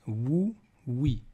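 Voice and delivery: "wou, wi" each begun with a w glide; consonant sound. Shown as a demonstration. Teacher showing waw as a consonant, "wou, wi" glided. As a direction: say "wou, wi" as separate w syllables. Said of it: "il peut aussi être une consonne".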